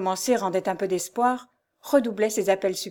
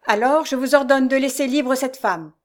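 loud, raised, imperious order; supreme command; 5 semitones higher, 5 dB louder than usual. A narrator's reading. Mysterious man orders the abductor, commanding. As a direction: order it with full authority. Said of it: "d'un ton de commandement suprême".

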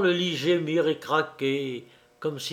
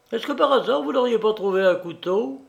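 higher, quick offhand query; guard's casual question; 7 semitones higher, faster than usual. Acted. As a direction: toss the question off lightly, fast.

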